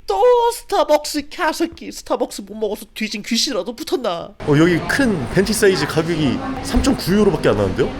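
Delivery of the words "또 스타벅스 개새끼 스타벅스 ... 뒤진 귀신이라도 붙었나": mock outburst. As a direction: yelp it in disbelief. Self-mocking outburst at Starbucks again.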